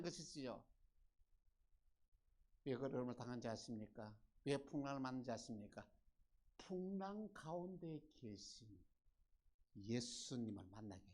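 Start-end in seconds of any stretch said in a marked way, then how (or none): none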